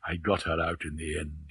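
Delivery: quiet gloating derision; derision and triumph. A narrator's reading. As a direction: softly savour the victory over her. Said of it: "with derision and triumph".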